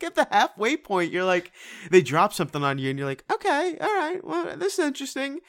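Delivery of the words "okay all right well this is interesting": high, pitched-up intrigue; curious, rising surprise; intrigued. Retold or imitated. Intrigued inner reaction quote.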